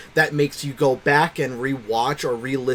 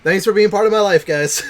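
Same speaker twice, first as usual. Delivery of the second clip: excited; 7 semitones higher, 7 dB louder than usual. Real, not acted. Playful thanks to viewer.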